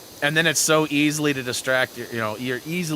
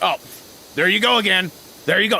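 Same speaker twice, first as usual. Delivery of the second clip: higher, louder exasperated dad impression; frustrated dad; 8 semitones higher, 8 dB louder, faster than usual. Dad fed up with son's robotic phone audio, exasperated shout. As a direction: imitate your fed-up father, raised voice. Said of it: "He'd get so frustrated".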